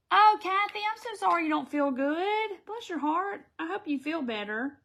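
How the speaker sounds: high, loud sympathetic coo. Real, not acted. To viewer Kathy who feels unwell, high sympathetic coo. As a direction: coo sympathy to a sick friend, bless her heart.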